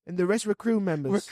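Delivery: high, loud mock-British report; terrible British accent. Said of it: "terrible British accent".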